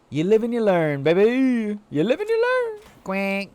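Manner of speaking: loud, then climbing to a silly squeal; hammed-up cheer, shooting up high; playful catchphrase.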